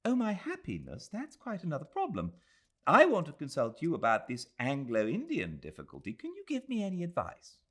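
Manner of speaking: put-on a worried young woman thinking aloud; young Englishwoman. Adela confessing her fear to Aziz, earnest.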